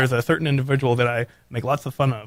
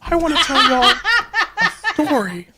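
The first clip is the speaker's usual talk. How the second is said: put-on high girly voice, laughing; squeaky girl voice telling a story; 11 semitones higher than usual. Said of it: "[name] has completely lost it".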